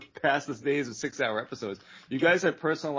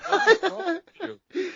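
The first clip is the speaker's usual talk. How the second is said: laughing scold; 13 semitones higher, 4 dB louder, slower than usual. Real, not acted.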